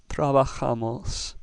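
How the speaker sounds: trabajamos said slowly with "ja" stressed; slow down and lean on "ja" in trabajamos. Shown as a demonstration. Teacher giving the correct version after wrong ones, stress on JA. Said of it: "with the ja syllable getting the emphasis".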